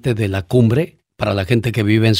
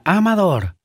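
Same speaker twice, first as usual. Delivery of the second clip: manager Helen's voice; 6 semitones higher than usual. Retold or imitated. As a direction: scold the cook, half in English. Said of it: "decía". (voz de gerente mandona)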